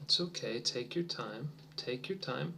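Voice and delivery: soft, gentle encouragement; reassuring. Real, not acted. Teacher calming a shy student, soft and reassuring. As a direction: ease the pressure, softly. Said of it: "reassuring a quiet student".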